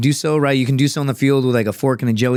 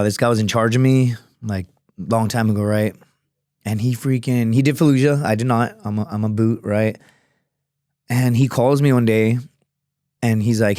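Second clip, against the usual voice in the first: usual speaking voice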